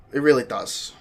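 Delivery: ordinary talk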